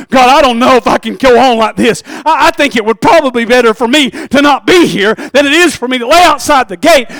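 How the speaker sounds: loud, high anguished plea to God; despairing cry. Acted. Lazarus crying out to God at the gate, anguished and loud. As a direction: cry out that you can't go on.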